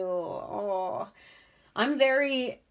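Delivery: real touch of tearful sympathy; choking up. Real, not acted. Empath feeling the pile's pain, slightly choked up. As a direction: voice lifts as you start to well up. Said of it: "I was, like, gonna cr-, choke up".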